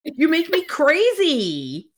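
hammed-up lover, fondly scolding her; exasperated hero. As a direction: play the smitten hero, mock-fed-up and fond. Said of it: "he goes".

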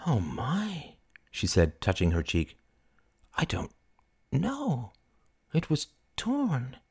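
soft, high, halting words; small, bewildered voice; dazed dismay. Acted. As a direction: falter as if just noticing. Dazed, mortified by torn dress.

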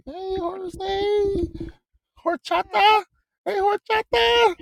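very high, put-on falsetto call; mock high-pitched holler; playful calling out. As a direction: sing out the name in a silly high voice.

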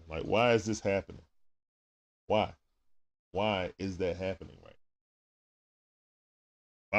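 quick running game commentary; live play-by-play. Acted. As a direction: call the game as it happens.

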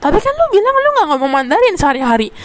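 high, whiny mock protest; imitating pushy insistence. Interviewer won't accept candidate's answer, mock argumentative. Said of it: "dia kayak masih nyolot".

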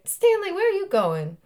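high, loud Mississippi stage call; Mississippi accent. Acted. Wife calling after husband, high Mississippi accent. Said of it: "Mississippi accents".

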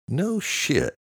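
high-pitched, impressed reaction; mildly amazed. A narrator's reading. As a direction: let the pitch jump, duly impressed. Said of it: "Luke muttered, duly impressed".

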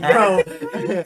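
high squealing laugh. Real, not acted. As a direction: burst out laughing. Laughing at friend's antics.

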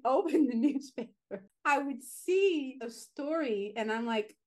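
usual speaking voice

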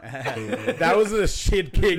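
loud, slow laughing yell at the kick; laughing shout. Real, not acted. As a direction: bellow it through laughter. Reacting to a terrible kick.

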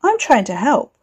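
a touch of hurt indignation; indignant. Acted. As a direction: protest as if wrongly accused. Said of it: "Ricky sounded indignant".

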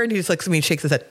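ordinary delivery

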